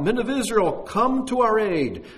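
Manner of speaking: high, loud cry for help from the crowd; a mob shouting for aid. A narrator's reading. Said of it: "crying out".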